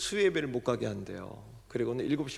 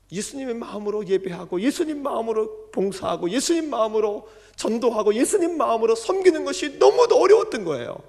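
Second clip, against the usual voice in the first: higher and louder with each 예수님의 마음으로; building preaching; 9 semitones higher, 8 dB louder than usual. Real, not acted. Pastor to congregation, building to full-force preaching. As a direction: hammer 예수님 mounting to a loud peak on 너무도. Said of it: "도무지".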